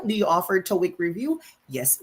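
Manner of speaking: ordinary delivery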